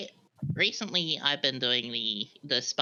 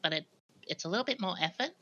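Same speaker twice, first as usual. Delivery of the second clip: a soft, higher everyday voice; softer higher voice; 5 semitones higher, 7 dB quieter than usual. Shown as a demonstration. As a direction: lift the pitch and soften it. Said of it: "I could probably talk like this".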